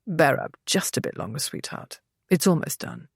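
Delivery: soft, tender murmur. A narrator's reading. Husband to his exhausted bride, soft murmur. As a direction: coax her gently, almost a whisper. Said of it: "Saint Vincent murmured".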